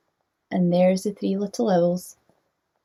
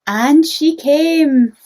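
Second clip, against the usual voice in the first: slow, louder, lifted; slow dramatic reveal; 7 semitones higher, 6 dB louder, much slower than usual. A narrator's reading. Storyteller revealing mother owl's return, slow and louder. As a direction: land it like the big happy moment. Climax of read-aloud.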